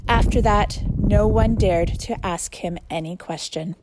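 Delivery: steady, clear Bible reading; measured public reading of a Gospel; scripture reading. Acted. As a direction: read the passage for the congregation. Reads scripture aloud.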